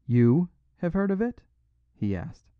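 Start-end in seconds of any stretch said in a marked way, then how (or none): 0.0-1.4 high, rising incredulous question; sudden interest. A narrator's reading. Robber questioning the priest, suddenly interested. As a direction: ask with pitch climbing to the end. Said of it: "with a sort of sudden interest".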